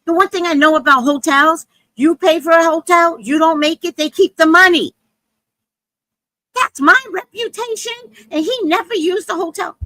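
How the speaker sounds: high, loud, defensive rant; indignant woman. Retold or imitated. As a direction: mimic her huffy self-justification. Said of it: "She goes".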